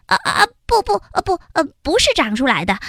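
high, fast stammering denial; flustered denial. Acted. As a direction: blurt out no, panicking. (黑黑慌张地否认)

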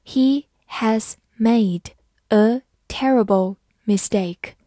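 slow, even reading pace; careful slow model reading. Shown as a demonstration. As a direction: read each word slowly. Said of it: "好，慢读一遍。".

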